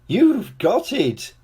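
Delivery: giggling. A narrator's reading. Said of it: "she giggled".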